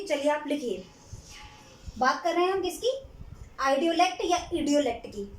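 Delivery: ordinary delivery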